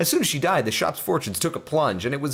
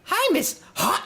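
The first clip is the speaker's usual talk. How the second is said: put-on squeaky high little girl; slower than usual. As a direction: pitch way up like a small girl. Voicing a girl in a read story.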